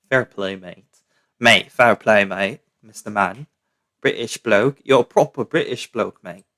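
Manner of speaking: playful put-on British bloke; mock British accent, laddish "mate". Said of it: "just excuse my British accent".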